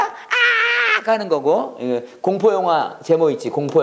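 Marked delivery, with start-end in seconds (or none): 0.0-1.1 high piercing scream "아!"; scream demo. Shown as a demonstration. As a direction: shriek like in a horror film. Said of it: "스크림 뭐야?".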